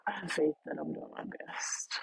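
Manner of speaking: soft, private reassurance; hushed, sincere whisper; whispered affirmation. Real, not acted. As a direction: whisper it, half to yourself. Quiet self-affirmation after pause.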